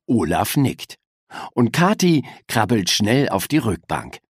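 ordinary delivery, nothing marked

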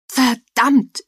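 high, loud curse; shout. A narrator's reading. Joel lunges at the burning building, high loud shout. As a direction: yell the curse out in despair. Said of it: "schrie er".

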